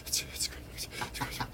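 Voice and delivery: put-on breathless awed whisper like a teen fan; starstruck fangirl whisper. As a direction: gasp it out, starstruck and hushed. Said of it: "teenage girls looking at the Beatles".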